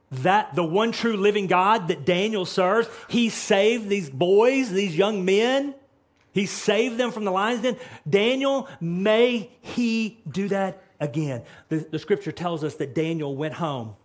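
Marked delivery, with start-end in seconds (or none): 0.1-11.4 loud, slow, high preaching build; fiery sermon climax; preaching at full force. Acted. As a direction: preach it out with rising fire.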